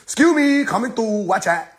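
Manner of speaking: high, snooty voice; mock snooty high voice; arrogant tsunami.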